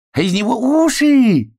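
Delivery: very high, loud, stretched-out cry; shouting. A narrator's reading. Hedgehog amused by hare's poking ears, shouting. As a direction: shriek it slowly with amusement. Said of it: "кричал ёжик".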